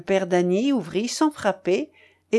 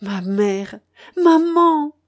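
builds from usual voice to high, loud cry; emotional cry; 6 semitones higher, 6 dB louder, much slower than usual. A narrator's reading. Son throwing himself into mother's arms, crying out. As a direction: burst out on the second word. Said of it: "cria Charles en se jetant dans les bras".

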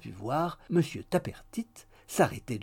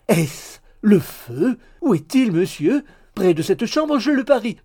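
usual speaking voice

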